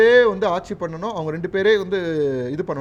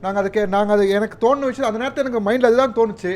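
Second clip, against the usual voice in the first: heated protest; 4 semitones higher, 6 dB louder than usual. Real, not acted. Heated on-air quarrel, defending himself.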